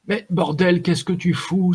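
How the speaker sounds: high-pitched, scandalised challenge; indignant. A narrator's reading. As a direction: burst out in indignant disbelief. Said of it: "s'indigna Dugam".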